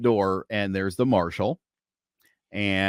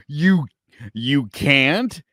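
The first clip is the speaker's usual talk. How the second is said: mock disbelief; 5 semitones higher, 5 dB louder, much slower than usual.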